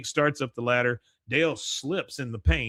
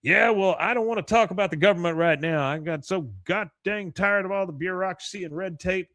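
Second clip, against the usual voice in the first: higher, louder griping; flustered rant; 6 semitones higher, 4 dB louder than usual. Retold or imitated. Hank rants to Dale about bureaucracy, higher and louder. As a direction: blurt out the complaint. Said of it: "He goes".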